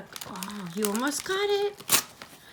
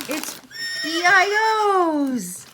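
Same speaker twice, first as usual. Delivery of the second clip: excited reveal; 8 semitones higher, 5 dB louder than usual. Real, not acted. Proud reveal of the book.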